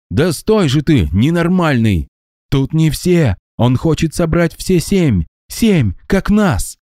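high, pleading, drawn-out; desperate ghost. A narrator's reading. Ghost warning Max about the seven, high desperate plea. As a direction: stop him before it's too late.

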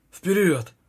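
commanding shout. A narrator's reading. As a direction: send the squad charging in. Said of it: "скомандовал капитан".

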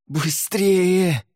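high urgent yell from below; rough, hoarse shout. A narrator's reading. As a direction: yell roughly for help to hurry. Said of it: "раздался грубый, хриплый голос".

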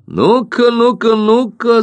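high barking call to the team; shouting coach. Acted. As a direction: bark it out like a gym coach. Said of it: "закричал тренер Террелл".

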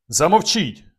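high, loud outburst; angry shout. A narrator's reading. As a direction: cry out to silence him. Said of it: "крикнув я".

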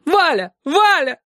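высокий громкий зов; зови мужа в панике; зов на помощь. Acted. Мама зовёт мужа на помощь. (мама в панике зовёт Валю)

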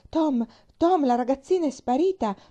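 high, loud wailing cry; lament. A narrator's reading. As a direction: wail the news in dismay. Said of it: "disse in un lamento".

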